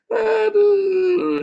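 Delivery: very high, loud imitated wail; mock cry of pain. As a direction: wail loudly as if hairs were yanked out. (tiruan jerit kesakitan melengking)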